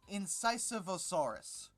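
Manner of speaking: ordinary talk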